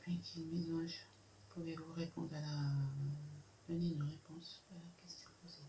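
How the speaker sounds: hushed whisper to the spirits. Real, not acted. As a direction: breathe the plea in a whisper. Whispered plea to guides.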